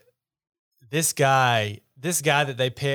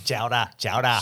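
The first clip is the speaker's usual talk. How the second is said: Boston chowder. Retold or imitated. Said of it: "say chowder".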